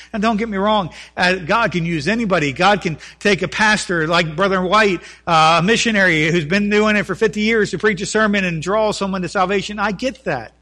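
loud, rising sermon delivery; fervent preaching. Acted. Preacher pleading with his church, loud and impassioned. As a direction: pastor urging the congregation, preaching loud and fervent.

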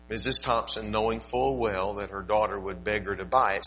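ordinary delivery

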